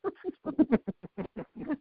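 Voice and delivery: high, soft laugh at his own joke. Real, not acted. Laughing at his own baking joke, high and soft. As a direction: let a light high laugh out.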